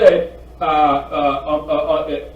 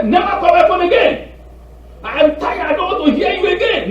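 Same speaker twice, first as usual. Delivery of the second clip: high, loud outburst at the caller; mock-angry shout, pitched way up; fed-up shout; 12 semitones higher, 4 dB louder than usual. Fed-up man telling scam callers off, angry shout.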